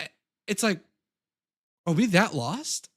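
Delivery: usual speaking voice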